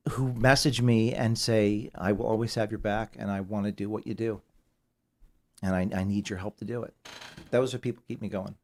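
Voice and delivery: quiet, fighting back tears; choked up. Real, not acted. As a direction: voice dropping soft with emotion. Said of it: "I'm gonna get emotional here".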